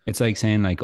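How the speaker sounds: ordinary delivery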